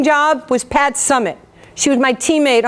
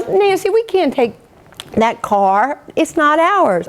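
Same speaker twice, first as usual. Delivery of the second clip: lightly mimicked Tennessee accent, pitched up; Holly's Tennessee English; 3 semitones higher than usual. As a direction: imitate a Tennessee roommate objecting. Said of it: "like, some broken English".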